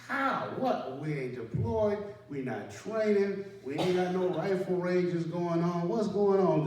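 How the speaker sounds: high, loud, alarmed questions; alarmed shout. Retold or imitated. Sergeant yelling at his team leader over the phone, alarmed. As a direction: fire off questions in rising alarm.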